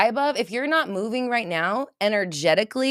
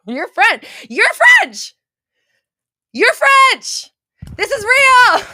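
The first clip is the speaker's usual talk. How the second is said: shrieking higher and louder with delight; excited shouting; 12 dB louder, slower than usual. Real, not acted. Real excited yelling. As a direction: streamer screams at the chat that the viewer is French, delighted. Viewer reveals French heritage, streamer erupts.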